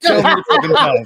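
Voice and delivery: loud, high, laughing hype; self-hype shout. Pretend. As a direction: hype your own name like a promo.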